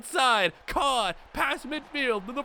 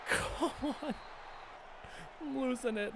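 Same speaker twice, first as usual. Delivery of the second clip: deflated mutter; 9 dB quieter than usual. Real, not acted. Frustrated after the interception.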